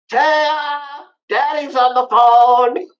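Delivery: very high, slow, sing-song dog voice; dog calling for Taya. Pretend. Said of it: "then you hear".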